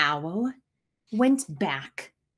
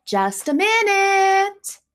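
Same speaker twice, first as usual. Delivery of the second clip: slow call out to the door; 9 semitones higher, 6 dB louder, slower than usual. A narrator's reading. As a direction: call out slow, as if to someone outside. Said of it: "said Al".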